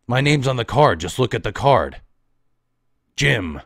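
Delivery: loud, exasperated mimicry; angry mock quote. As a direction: bark it like the customer's rudeness annoyed you. Angry mimic of rude customer.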